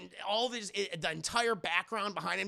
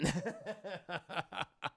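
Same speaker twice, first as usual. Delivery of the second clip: soft real laugh; quiet trailing laughter; amused chuckle; 6 dB quieter than usual. Real, not acted. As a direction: chuckle quietly at your own joke. Amused by own joke.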